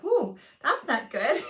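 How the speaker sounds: slow, amused ooh with a laugh; slowed, laughing dismay; laughing quote. Retold or imitated. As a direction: chuckle through the worry. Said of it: "I was like".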